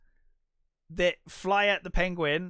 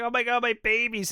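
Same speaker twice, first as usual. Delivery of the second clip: mock upset wail; 7 semitones higher than usual. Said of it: "really upset".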